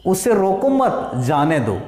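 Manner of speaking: slightly drawn-out उसे रोको मत, break before जाने दो; comma after मत. Shown as a demonstration. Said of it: "केवल कॉमा का फर्क है". (मत के बाद विराम, फिर "जाने दो")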